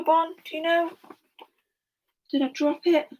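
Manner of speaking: high cooing pet talk; high-pitched questions to a beloved pet. Real, not acted. Chatting to a pet mid-lesson.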